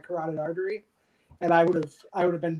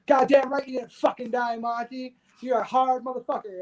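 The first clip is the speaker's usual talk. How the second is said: tough army sergeant, higher; gruff sergeant; 6 semitones higher than usual. Retold or imitated. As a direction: bark it like a first sergeant. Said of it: "looks down at me and goes".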